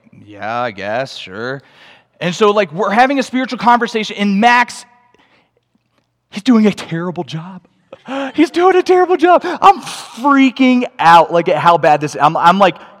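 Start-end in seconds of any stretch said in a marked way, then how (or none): 0.1-1.6 low, slow, half-hearted acceptance; grudging shrug of a yes; reluctant agreement. Retold or imitated. Strangers reluctantly agree to talk. 8.1-11.3 gasp, then high, loud freak-out; hammed-up alarm at a disaster; acted panic. Retelling his own panic.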